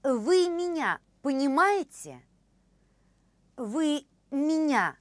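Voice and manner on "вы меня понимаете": normal talking voice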